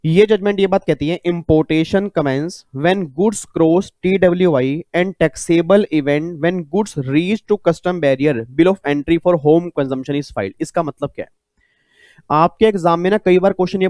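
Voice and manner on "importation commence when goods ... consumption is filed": reads each word very slowly for note-taking; slow dictating teacher voice; slow dictation. Shown as a demonstration. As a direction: spell it out slowly so students can write it down. Reads judgment text slowly for notes.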